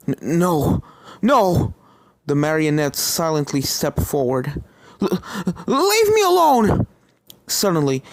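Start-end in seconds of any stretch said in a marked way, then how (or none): 0.1-1.7 stammering, rising fearful cry; frightened. Acted. Starlight backs away shaking. 5.0-6.9 terrified scream. Acted. Starlight screams at the figure.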